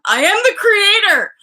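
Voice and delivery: high, loud grand declaration; hammed-up triumphant shout; triumphant proclamation. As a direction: proclaim it like a sudden revelation.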